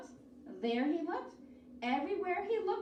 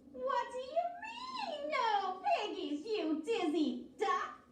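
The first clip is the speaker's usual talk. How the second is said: high screech, slowing down; screeching witch; 8 semitones higher than usual. A narrator's reading. Witch screeching into the duck's bill, high. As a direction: screech it right into his bill. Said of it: "Gretch screeched into his bill".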